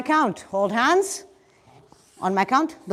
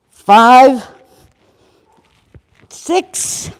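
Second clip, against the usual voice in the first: loud, punchy fitness count; coach counting reps; 10 dB louder, much slower than usual. Acted. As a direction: call the reps out to the class. Leads the class counting reps.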